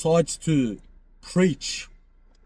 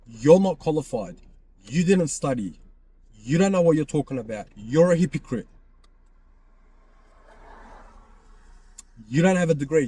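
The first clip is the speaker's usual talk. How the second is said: louder, emphatic acted reproach; mock accusation; 4 dB louder than usual. Speaker acting out a critic telling someone face to face, accusing. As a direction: lay out each charge forcefully, as if confronting someone.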